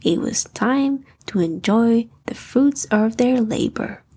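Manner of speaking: plain reading voice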